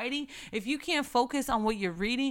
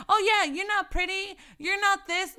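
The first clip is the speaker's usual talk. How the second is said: higher, sneering voice of the devil; lightly voiced devil; mock Satan; 7 semitones higher, 3 dB louder than usual. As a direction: play a mild taunting Satan. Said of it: "You think he's just gonna say".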